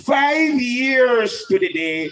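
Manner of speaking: slow, booming proclamation; proclaiming. Real, not acted. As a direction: call it out slowly to the crowd. Preacher proclaims anniversary to crowd.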